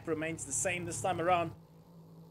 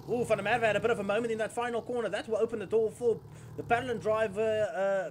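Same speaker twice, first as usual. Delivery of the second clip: fast, loud, higher excited race call; motorsport commentator; 4 semitones higher, 7 dB louder than usual. Real, not acted. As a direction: blurt out the near-spin in a rush. Driver's moment in final corner.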